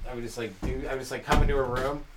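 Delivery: ordinary talk, nothing marked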